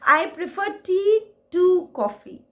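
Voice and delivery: teacher gives the right answer with emphasis; correct version, emphatic. Shown as a demonstration. Emphatic slow correct sentence. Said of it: "नहीं".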